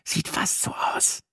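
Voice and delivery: breathy whispered aside. Acted. Sina whispers back to her boyfriend, whispered aside. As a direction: whisper it so nobody hears.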